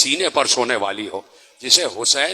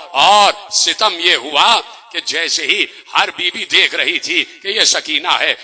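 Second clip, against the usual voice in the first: normal talking voice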